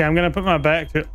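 usual speaking voice

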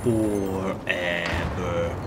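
very slow, drawn-out word; stretched out. Pretend. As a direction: drag the word out. Echoing his own word, stretched.

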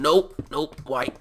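mock protest. Gamer pleading with a mean game, mock-wounded protest. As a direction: protest like you are being picked on.

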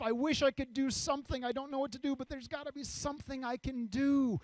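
high-pitched, urgent longing; acted restless desire to help; earnest yearning. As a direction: plead with eager helplessness. Voices the urge to serve.

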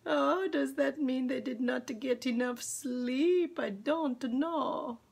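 normal talking voice

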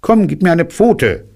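deutlich höher, eifrig gesprochen; hohe Kaninchenstimme. Acted. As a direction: das Kaninchen mit hoher Stimme. Kaninchen spricht zu Puh. (hohe Kaninchenstimme, auffordernd)

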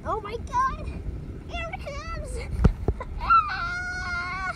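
startled shriek as the gust hits; scream, suddenly terrified; panicked scream. Acted. Puppet kid panicking as the wind comes.

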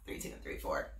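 breathy count under the breath; whispered count-in. Real, not acted. Quiet counting.

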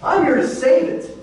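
Jesus speaking, pitched high and earnest; voicing Jesus. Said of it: "Jesus says".

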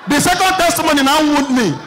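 ordinary delivery

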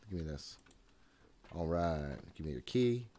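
ordinary talk

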